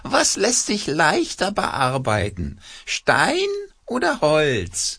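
high, slow quacking puppet. A narrator's reading. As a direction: squawk it out like a cloth puppet. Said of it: "mit verstellter Stimme quakt er los".

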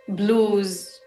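ordinary talk

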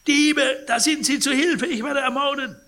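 high, loud terrified shriek; piercing scream. Acted. Sick man wakes screaming at thieves, loud shriek. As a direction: scream for help in panic. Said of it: "stieß einen durchdringenden Schrei aus".

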